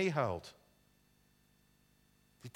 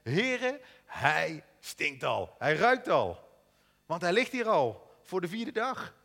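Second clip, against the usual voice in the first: Martha's protest; 3 semitones higher, 7 dB louder, slower than usual. A narrator's reading. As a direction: plead with Jesus, voice raised. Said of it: "Martha ... zei tegen hem".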